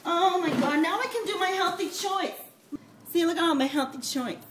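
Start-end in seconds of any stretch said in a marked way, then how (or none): none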